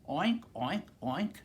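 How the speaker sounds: short, loud, high oinks; pig oinking. A narrator's reading. Reader to children as the pig, loud oinking. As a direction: oink away like a pig. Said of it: "grunted the pig".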